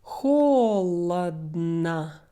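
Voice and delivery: "Холодно" stretched slowly, ending on an open A; very slow "Холодно", stressed start, open final vowel. Shown as a demonstration. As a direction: model "Холодно" as HO-luhd-na. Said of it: "sounds more like a".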